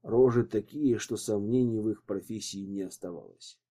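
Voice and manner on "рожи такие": plain reading voice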